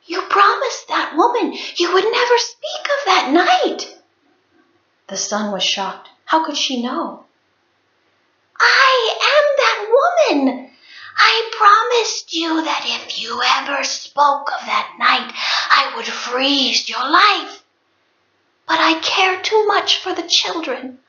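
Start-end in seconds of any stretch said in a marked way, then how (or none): 0.0-4.0 angry. A narrator's reading. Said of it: "angrily said". 8.6-21.0 high, intense reveal of the ghostly wife; revealed snow spirit. A narrator's reading. Wife revealing herself as the snow woman to her husband, high and intense.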